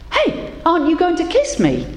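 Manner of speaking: high, indignant demand; impatient frog. A narrator's reading. Frog in the pocket demands the woman's kiss, high and impatient. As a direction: protest sharply, put out. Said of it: "asked the frog impatiently".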